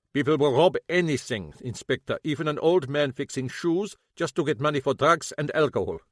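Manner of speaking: lightly voiced old German man, bitter; old German shoemaker, a hint of German accent; German-accented cobbler. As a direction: grumble it with a German accent, grieving.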